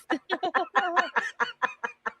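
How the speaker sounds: long, real high-pitched laugh; high laughter. Real, not acted. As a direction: keep laughing, high and helpless. Amused by the advice.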